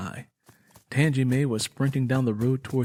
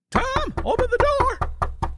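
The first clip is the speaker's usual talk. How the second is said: much higher, slow, crying out; demanding call. A narrator's reading. A familiar voice outside demanding Tom open up, crying out high. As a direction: call through the door urgently. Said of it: "demanded immediate action".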